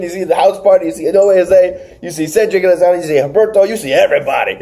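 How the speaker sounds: normal talking voice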